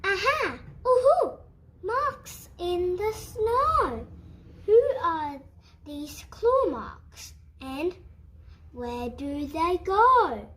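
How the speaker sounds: high, loud owl hoots and cries; hooting owl. Acted. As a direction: call out like a delighted owl. Owl calling out at the snow tracks.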